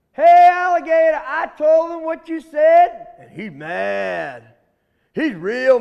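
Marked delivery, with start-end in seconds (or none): 0.1-3.1 high, loud holler across the swamp. Acted. Rabbit calling across to the alligator, hollering loud and high. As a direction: call out to him from far away. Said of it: "Rabbit hollers out".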